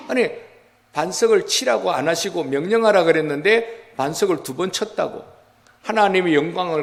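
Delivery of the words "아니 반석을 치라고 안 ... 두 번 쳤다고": ordinary talk, nothing marked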